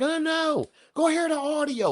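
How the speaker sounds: high, squeaky mock voice; mocking whiny voice. Vlogger mimics commenters telling him to hear the audio, high mocking. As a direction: parody them in a silly high voice. Mocking people who come in.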